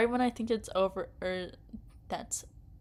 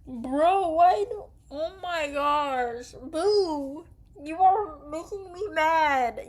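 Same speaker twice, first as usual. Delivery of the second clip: high, loud whiny complaint; frustrated outburst; 8 semitones higher, 6 dB louder, slower than usual. Real, not acted. Gamer yelling at the game choice, frustrated and whiny. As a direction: complain loudly at the screen.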